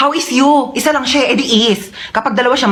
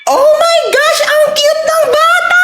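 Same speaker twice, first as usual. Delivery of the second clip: very high, slow squeal; gushing squeal; slower than usual. Acted. Visitor gushing over the cute baby, very high slow squeal.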